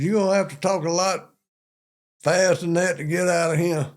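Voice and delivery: drill sergeant voice. Retold or imitated. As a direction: snap at the recruit like a drill sergeant. Drill sergeant quote in story.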